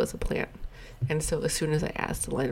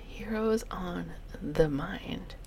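Heroes on the Mind, slow and hushed; whispered; 2 semitones higher, 6 dB quieter, much slower than usual. Shown as a demonstration. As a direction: breathe it out slowly. Repeating the title in a whisper.

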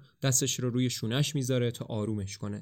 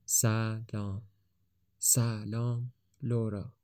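tender; 5 dB quieter, much slower than usual. A narrator's reading. Greeting her name, tender.

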